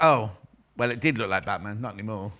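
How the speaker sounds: ordinary talk, nothing marked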